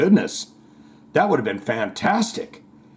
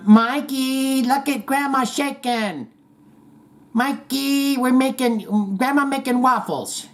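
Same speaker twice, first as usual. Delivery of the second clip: put-on much higher grandma calling to the kid; playful grandma voice; 10 semitones higher than usual. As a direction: play a giddy grandma showing off to Mikey. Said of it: "And she's going".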